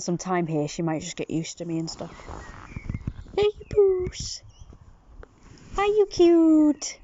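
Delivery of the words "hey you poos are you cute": much higher, slow cooing at a kitten. Real, not acted. Owner coos high to the shy cat. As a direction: coo it up high like to a pet.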